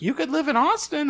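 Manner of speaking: high mock parent voice; mimicking parents. Son imitating his parents' casual suggestion, pitched high.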